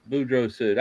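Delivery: ordinary delivery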